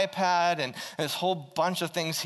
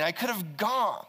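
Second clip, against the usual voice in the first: usual speaking voice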